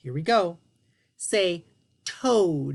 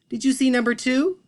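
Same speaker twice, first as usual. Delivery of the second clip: normal talking voice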